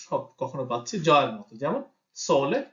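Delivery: ordinary talk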